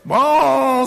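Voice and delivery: high, loud, drawn-out bellow; bawling calf. A narrator's reading. Star the calf as the sled bounces, bawling. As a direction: bawl like a startled calf. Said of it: "said Bright".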